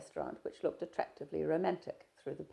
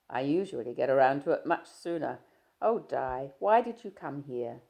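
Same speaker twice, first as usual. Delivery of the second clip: a louder, lightly voiced young man, breezy; Stephen, teasing; 8 dB louder than usual. Stephen greeting his shy friend, easy and teasing. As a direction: play Stephen chatting easily, a touch louder.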